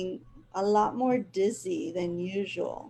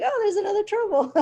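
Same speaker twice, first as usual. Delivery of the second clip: much higher, fast, laughing; delighted recognition, laughing and quick; 12 semitones higher, 6 dB louder, faster than usual. Retold or imitated. Sees the other cat.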